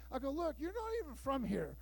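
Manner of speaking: usual speaking voice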